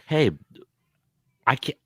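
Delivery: ordinary talk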